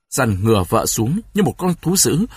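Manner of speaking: ordinary delivery, nothing marked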